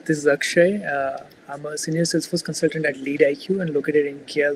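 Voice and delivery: ordinary delivery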